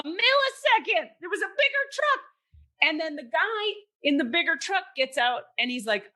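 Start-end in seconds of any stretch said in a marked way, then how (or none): 0.0-2.2 amazed disbelief. Real, not acted. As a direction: squeal it out like you cannot believe it. Disbelief at sudden truck.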